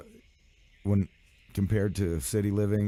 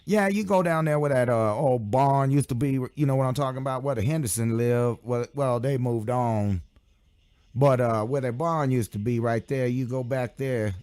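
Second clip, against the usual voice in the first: higher-pitched rambling country folk; country local; 6 semitones higher than usual. Retold or imitated. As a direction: imitate a country local giving meandering directions. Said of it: "the country and they're like".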